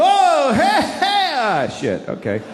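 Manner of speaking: rising excited gasp falling to a disappointed mutter; mock crowd watching a giant bubble burst; crowd oohing at a bubble. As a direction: cheer the bubble then groan as it pops. Bubble crowd reacting to big bubble.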